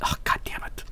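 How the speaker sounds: whisper. Real, not acted. Whispered curse.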